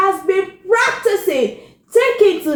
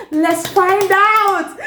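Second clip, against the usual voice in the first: plain reading voice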